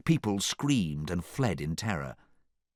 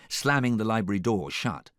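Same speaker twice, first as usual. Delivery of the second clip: plain reading voice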